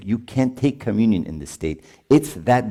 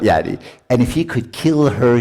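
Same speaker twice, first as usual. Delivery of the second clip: ordinary talk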